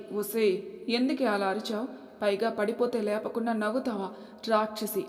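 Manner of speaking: plain reading voice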